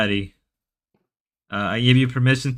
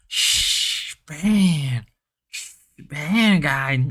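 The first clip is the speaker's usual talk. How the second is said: mock Ruby shushing; 2 semitones higher, much slower than usual. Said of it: "the Ruby and the Grace voice".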